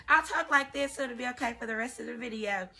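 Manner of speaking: a hint of country accent. Shown as a demonstration. Telling viewers she'll keep her country accent, light Southern twang. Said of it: "with a little country accent".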